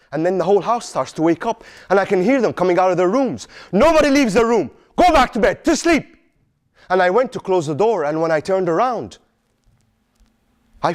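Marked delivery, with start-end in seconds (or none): 3.7-6.2 high, firm command to the family; stern order at night. Acted. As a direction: send everyone back to bed, sharp. Father orders household back to bed.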